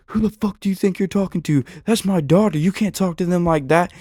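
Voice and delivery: loud, fast, furious retold challenge; angry dad squaring up, loud and quick; angry confrontation. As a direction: get in his face and bark it. Angry husband confronting him.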